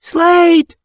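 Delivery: loud high call across a clearing. Acted. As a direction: call out to someone far away. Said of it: "Mothflight called across the clearing".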